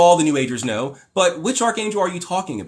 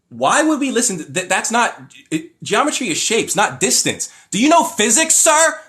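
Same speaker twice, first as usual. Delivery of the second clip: heated, higher-pitched exasperation; worked up; 5 semitones higher than usual. Real, not acted. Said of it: "Let me calm down".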